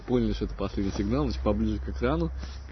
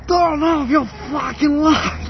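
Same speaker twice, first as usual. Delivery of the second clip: much higher furious yelled command; furious shout; 9 dB louder, much slower than usual. Retold or imitated. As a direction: scream it, patience gone. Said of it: "такой просто уже нахрен".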